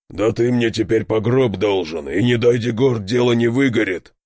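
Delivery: gruff bear growl with a grumble. A narrator's reading. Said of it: "прорычал Медведит".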